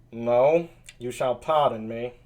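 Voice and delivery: low, nasal character voice; nasally Benedick. Acted. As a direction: say it low through the nose. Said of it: "kind of a nasally sound".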